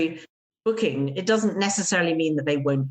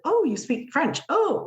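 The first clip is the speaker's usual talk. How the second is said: surprised realization; 9 semitones higher than usual. Retold or imitated. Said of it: "And they".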